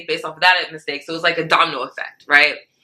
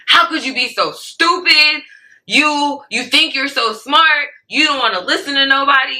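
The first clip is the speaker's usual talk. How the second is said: retold angry boss, pitched up; yelling boss; 8 semitones higher than usual. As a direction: imitate the boss berating her. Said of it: "He's just, like, yelling at me".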